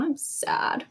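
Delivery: a soft little 'sad' demo; acting sad. Shown as a demonstration. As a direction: play sad for children, softly. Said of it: "My eyes go down, my face".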